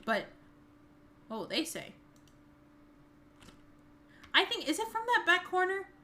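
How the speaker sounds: normal talking voice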